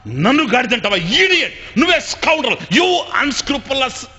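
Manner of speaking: high, loud mock insults; a wife hurling fake abuse at full volume; staged shouting match. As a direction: yell the English insults and drag the last one out slowly. Wife shouts back in staged fight.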